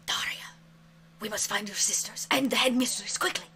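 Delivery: urgent whisper to Daria. Acted. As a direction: hurry her along in a whisper. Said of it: "He whis".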